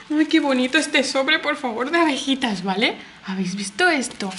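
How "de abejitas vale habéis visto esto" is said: ordinary talk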